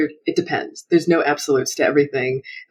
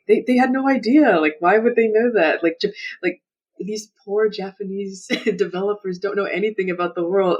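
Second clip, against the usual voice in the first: higher, incredulous imitation of excuse-makers; mock naive defender; 5 semitones higher than usual. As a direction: imitate people pleading ignorance on their behalf, pitched up. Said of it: "people love to be like".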